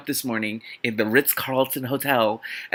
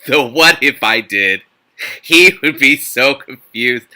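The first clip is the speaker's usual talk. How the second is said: giggly; 11 dB louder, slower than usual. Real, not acted. Giggly musing, loud and slow.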